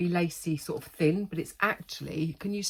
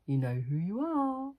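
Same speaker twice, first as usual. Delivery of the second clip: tender; 4 semitones higher, slower than usual. Real, not acted. Tender message to family.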